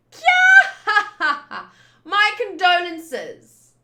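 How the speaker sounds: high, loud laughing jeer; mocking laugh. Villainess mocking Usopp, a loud high laughing jeer. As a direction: laugh and mock the loser.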